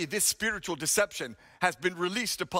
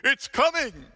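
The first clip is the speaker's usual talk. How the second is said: loud, high proclamation; bold declaration; 5 semitones higher, 6 dB louder than usual. Retold or imitated. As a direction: announce it boldly to the congregation. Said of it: "declared boldly".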